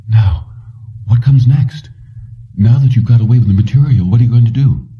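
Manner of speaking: a hint of Kelly warning him; Kelly, relieved. Kelly to Jennings at her door, lightly voiced worry.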